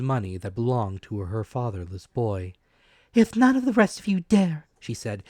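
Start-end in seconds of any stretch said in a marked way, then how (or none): 3.1-4.7 higher, louder, a brave scolding mother; mother's defiant voice. A narrator's reading. Mother scolding the villagers, high and loud. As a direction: shame the men into helping.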